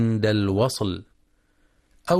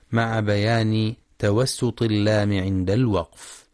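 normal talking voice

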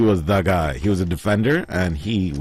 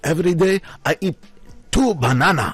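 put-on higher, faster clipped Japanese accent; mock Japanese-accented English, pitched up; fake Japanese accent; 11 semitones higher than usual. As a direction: play up a joking Japanese accent. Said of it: "he fakes a Japanese accent".

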